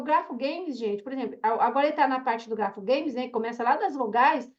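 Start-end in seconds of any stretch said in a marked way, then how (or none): none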